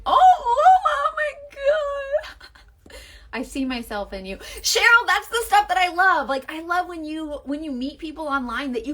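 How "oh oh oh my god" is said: high, loud, stretched cry of emotion; overwhelmed, voice breaking into tears; real tearful oh my God. Real, not acted. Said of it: "now I'm crying".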